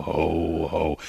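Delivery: ordinary talk, nothing marked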